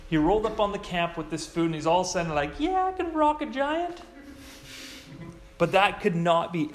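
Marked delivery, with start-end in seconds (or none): 2.6-4.0 very high, breezy mock boast; sending up an overconfident kid; mock cocky David.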